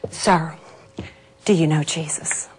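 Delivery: soft, low, gentle question; tender question. Retold or imitated. Kneeling before the old man, soft and tender. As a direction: ask him gently.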